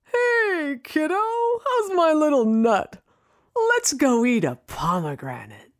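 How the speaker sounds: a high, hearty dad voice; dad's voice. Retold or imitated. Daughter mimicking her dad's high, jokey greeting. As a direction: play the cheerful dad greeting his kid. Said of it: "it was things like".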